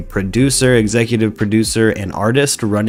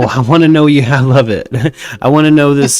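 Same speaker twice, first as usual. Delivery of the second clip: excited; 5 semitones higher, 7 dB louder than usual. Real, not acted. Excited over guest's theory.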